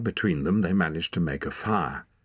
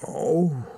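high moan of pain; 10 semitones higher, 4 dB louder than usual. A narrator's reading. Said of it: "he moaned".

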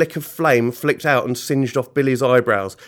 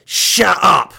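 loud, higher-pitched villain bellow; angry roar; 7 semitones higher, 8 dB louder than usual. Acted. Mister Gum silencing Billy, roaring. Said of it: "roared Mister Gum".

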